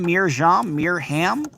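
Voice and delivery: Meerschaum as meer-ZHAHM, Meerham as meer-HAM; guessing pronunciations. Shown as a demonstration. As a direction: try Meerschaum and Meerham as high questions. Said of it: "how to pronounce her name".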